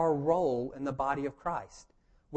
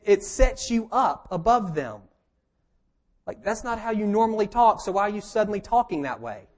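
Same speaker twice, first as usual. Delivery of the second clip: ordinary talk, nothing marked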